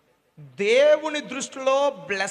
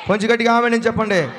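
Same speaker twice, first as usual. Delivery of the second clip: louder call; 7 dB louder, faster than usual. Shown as a demonstration. Pastor urging the congregation to say amen, shouting it louder. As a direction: repeat it louder, pushing the crowd.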